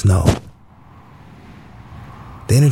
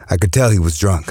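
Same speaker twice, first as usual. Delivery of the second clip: ordinary delivery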